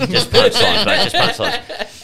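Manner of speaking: high, laughing through the words; laughing while quoting. Retold or imitated. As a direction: crack up mid-quote. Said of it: "he goes".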